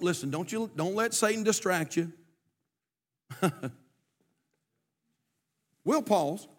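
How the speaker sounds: ordinary talk, nothing marked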